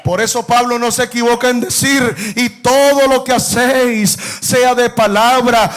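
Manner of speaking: full-force preaching, almost shouting; rising preacher's fervor. Acted. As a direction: lift the church up with each phrase.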